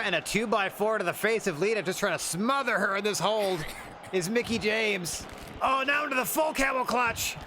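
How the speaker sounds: rising, excited play-by-play; wrestling commentator. Acted.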